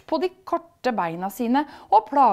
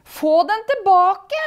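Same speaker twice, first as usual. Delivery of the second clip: high, slow, loud whine; whining; 11 semitones higher, 8 dB louder, slower than usual. A narrator's reading. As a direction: whine for the crown back. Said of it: "sutrer drømmeprinsen".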